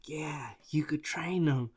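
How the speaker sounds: whispered, sly military man; scheming military guy. Retold or imitated. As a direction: hiss it like a plotting officer. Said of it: "military guy who was like".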